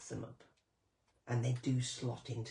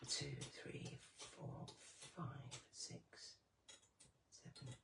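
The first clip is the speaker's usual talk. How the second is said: counting under the breath, unvoiced; whispered counting; 15 dB quieter, slower than usual. Real, not acted. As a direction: whisper the numbers to yourself. Counting quietly while working.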